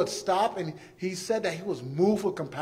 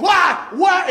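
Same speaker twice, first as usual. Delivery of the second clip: high, loud indignant cry; Jesus shouting in the temple, furious; angry shout; 10 semitones higher, 10 dB louder than usual. Retold or imitated. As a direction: drive them out of the temple. Jesus chasing people out.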